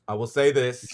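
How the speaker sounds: ordinary talk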